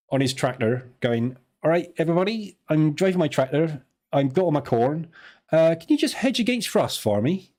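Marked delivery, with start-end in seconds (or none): 1.6-7.5 higher-pitched Somerset farmer voice; Somerset farmer accent. Acted. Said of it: "That's how farmers talk in Somerset".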